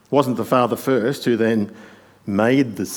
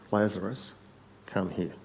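quietly said; 8 dB quieter than usual. Acted. Jesus telling Lazarus to come out, quietly said. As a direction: say it softly, dropping at the end. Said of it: "Jesus said".

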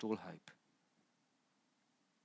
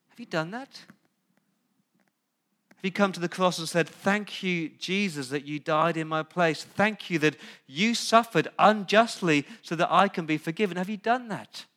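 louder and higher, urgent thanks; fervent preaching; 7 semitones higher, 8 dB louder than usual. Acted. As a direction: press the question home with force. Preaching appeal.

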